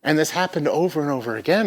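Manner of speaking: ordinary talk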